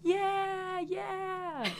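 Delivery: high, drawn-out mock crowd cheer; playful cheering. Guest mock-cheers her own introduction.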